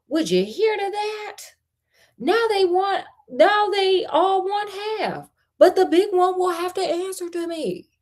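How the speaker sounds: high, put-on grumbling peasant voice; peasant grumbling to himself. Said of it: "said to himself".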